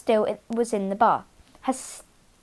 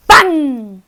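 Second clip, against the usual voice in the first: bang; 2 semitones higher, 11 dB louder than usual. Acted. Vacuum explodes.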